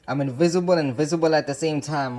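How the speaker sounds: ordinary delivery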